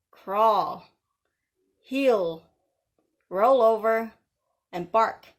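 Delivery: sharp, loud commands to a pet; loud dog commands. Pretend. Commanding haters like dogs.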